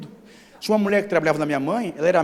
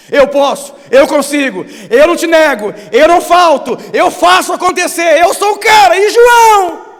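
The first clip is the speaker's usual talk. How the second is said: loud, high bragging; boastful Peter; 12 semitones higher, 16 dB louder than usual. Retold or imitated. Pastor voices Peter boasting to God, loud bravado. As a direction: strut each eu like a big shot. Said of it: "voicing Peter boasting".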